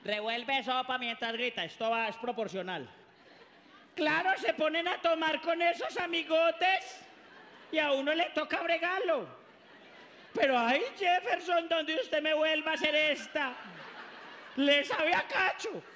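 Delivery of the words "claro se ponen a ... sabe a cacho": higher, louder scolding mom; ranting mother. Acted. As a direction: threaten the son like an angry mother. Mother ranting at drunk son.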